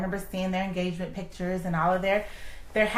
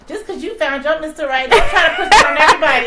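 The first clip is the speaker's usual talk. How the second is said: loud, high, rushing and laughing; mock exasperated retort; 7 semitones higher, 11 dB louder than usual. To the newly engaged friend, loud laughing exasperation. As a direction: blurt it out fast, half laughing.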